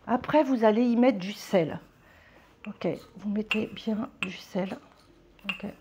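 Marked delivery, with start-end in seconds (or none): 2.7-5.7 whisper. Real, not acted. Aside while pouring salt.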